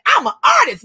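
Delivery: high, loud excited declaration; proud child exclamation. Retold or imitated. Child announcing to parents after jumping back, proud exclamation.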